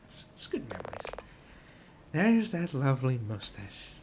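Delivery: ordinary talk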